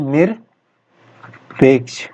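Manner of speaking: "निरपेक्ष" stretched very slowly, sound by sound; slow dictation. Shown as a demonstration. Teacher to students, dictating "निरपेक्ष" very slowly. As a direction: draw out "निरपेक्ष" syllable by syllable. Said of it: "निरपेक्ष को किस तरीके से लिखते हैं".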